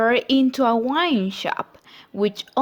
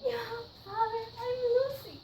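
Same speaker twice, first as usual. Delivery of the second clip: high and much softer, trembling call; soft, high tearful calling to a lost father; tearful plea; 11 semitones higher, 14 dB quieter, much faster than usual. Acted. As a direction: reach out tenderly through tears. Daughter pleads with father.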